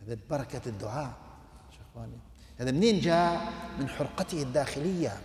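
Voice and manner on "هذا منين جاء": ordinary talk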